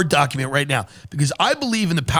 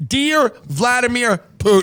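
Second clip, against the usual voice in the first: letter reading; 6 semitones higher, slower than usual. Shown as a demonstration. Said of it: "reading letter opening".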